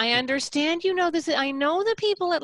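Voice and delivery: sweet lilt, the way not to do it; wrong lilting tone. Shown as a demonstration. Coach showing the wrong soothing lilt to the mother. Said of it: "it can't have that lilt".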